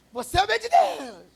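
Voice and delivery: high, loud mock shout from across the store; imitated shouting man. Preacher imitating a man yelling at him in the grocery store, loud shout. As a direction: holler it out like an excited stranger. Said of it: "O cara veio gritando".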